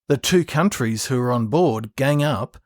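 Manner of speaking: ordinary delivery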